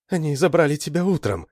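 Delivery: high, lightly acted tearful girl. A narrator's reading. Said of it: "плакала она".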